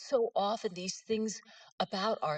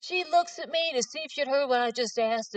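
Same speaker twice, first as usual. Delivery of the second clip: usual speaking voice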